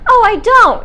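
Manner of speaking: quoting friend, high and loud; squeaky high casual reply; high mock voice. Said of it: "Merlin's like".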